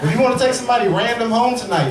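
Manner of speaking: ordinary talk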